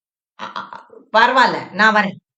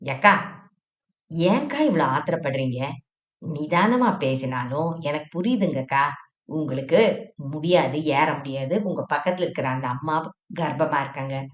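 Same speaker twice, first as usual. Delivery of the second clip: a touch lower and softer, polite traveller; gently lowered voice asking to be seated; lightly voiced Shenbagam; 5 semitones lower, 6 dB quieter than usual. Shenbagam asking the seated woman to move, polite and low.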